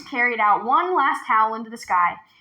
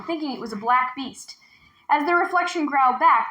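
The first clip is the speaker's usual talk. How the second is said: ordinary delivery, nothing marked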